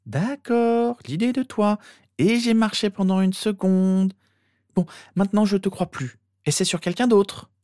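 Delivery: put-on high-pitched girl's voice; unconvinced sister. A narrator's reading. Sister no longer believing her brother, dismissive.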